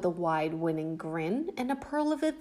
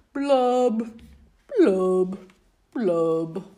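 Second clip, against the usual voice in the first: louder sulky singsong with booming blubs; loud gloomy fish refrain; 5 dB louder than usual. Acted. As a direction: belt the mopey refrain. Refrain again.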